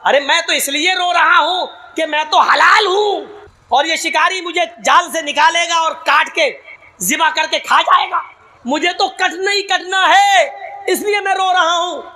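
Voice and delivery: a half-comic pigeon crying over its fate; wailing pigeon. Retold or imitated. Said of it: "कबूतर बोला".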